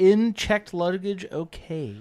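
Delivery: put-on slow, high British accent reading a question. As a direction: read it like a put-on British viewer. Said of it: "I'm only doing my Stan impression".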